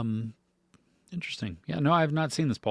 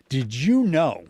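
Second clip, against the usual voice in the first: usual speaking voice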